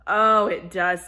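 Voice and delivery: loud, slow delighted oh; a touch of drooling delight. Acted. Dog greets the bread smell, loud and slow.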